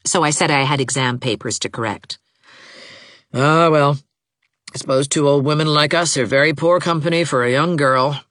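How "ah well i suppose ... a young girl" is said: put-on mildly martyred old woman voice; lightly voiced, resigned matriarch; sighing old mother. A narrator's reading. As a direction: sigh it with a touch of self-pity. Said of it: "sighed Missus Gibson".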